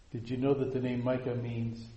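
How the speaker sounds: ordinary talk, nothing marked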